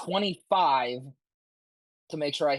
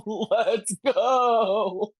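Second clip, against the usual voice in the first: loud, high victory cheer; excited cheer; 6 semitones higher, 4 dB louder than usual. Real, not acted. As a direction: whoop it out in triumph. Celebrating the kill.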